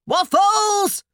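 very high, loud screech. A narrator's reading. Wife demanding her breakfast, screeching. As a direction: screech it out of the window. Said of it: "screeched the voice from the window".

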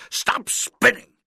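loud commands, the first one highest; shouted orders. A narrator's reading. Coach ordering the dizzy boy over to him, loud shouting. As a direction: bark the orders so he obeys at once. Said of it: "Coach shouted".

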